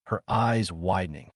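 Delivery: usual speaking voice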